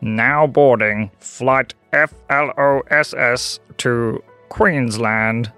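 very slow, spaced-out tannoy call; airport PA announcement. Acted. Airport announcer calls the flight, slow PA voice. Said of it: "over the P A system".

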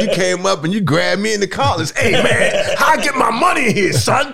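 high, loud street hustler demand; mock tough shout. Comedian imitating Tony's brash demand, laughing shout. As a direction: bark it like grabbing a collar. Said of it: "he said".

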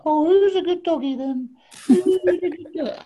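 high cooing voice to a dog; sing-song baby talk to a pet; doggy baby talk. Retold or imitated. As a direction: coo at the little dog like a pet owner. Mickey Rourke cooing to his dog.

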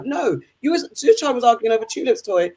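ordinary talk, nothing marked

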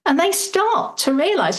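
normal talking voice

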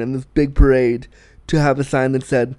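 ordinary talk

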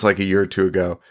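normal talking voice

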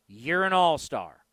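ordinary talk, nothing marked

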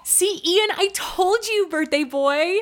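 very high, thrilled gushing; high-pitched party excitement; delighted squealing. Acted. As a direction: show off the birthday surprise triumphantly. Showing off surprise at party.

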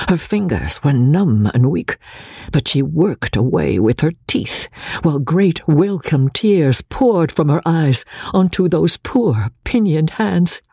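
ordinary delivery

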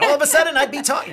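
hammed-up high, loud cheer; over-happy read gone wrong. Shown as a demonstration. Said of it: "I just go way in the wrong direction".

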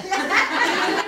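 a bright laugh up high; high burst of laughter. Real, not acted. Retelling her cryptic post, she bursts into high laughter. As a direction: laugh at how absurd it sounds.

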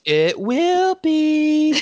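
very high, drawn-out mock coo; cringy lover. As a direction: stretch it out in a sugary falsetto. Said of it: "they're just so cringy".

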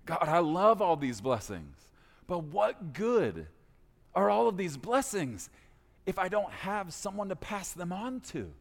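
a lightly voiced Abram asking God; pleading Abram. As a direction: Abram making his case to God. Pastor paraphrases Abram's plea.

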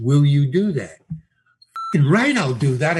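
ordinary talk, nothing marked

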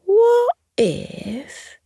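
high, drawn-out what if; slowly. A narrator's reading. Fox slowly hitting on an idea for the squirrel. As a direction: stretch it as the idea dawns. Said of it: "he said slowly".

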